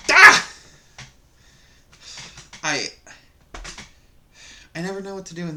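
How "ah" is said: loud, high burst of a yell; sudden shouted cry as if landing a blow; explosive high exclamation; mock striking yell. Miming smacking the man.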